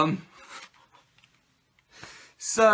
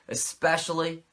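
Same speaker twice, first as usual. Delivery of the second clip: ordinary talk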